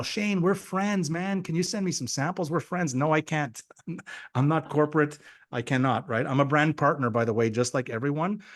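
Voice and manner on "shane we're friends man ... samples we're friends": high, wheedling mock plea; pleading friends. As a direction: imitate a friend begging a favour. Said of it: "I've got people right now".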